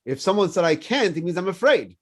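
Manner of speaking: ordinary talk, nothing marked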